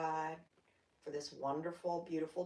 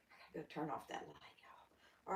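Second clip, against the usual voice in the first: whispered aside; 4 semitones lower, 10 dB quieter than usual. Real, not acted. Aside while leaving to fix light.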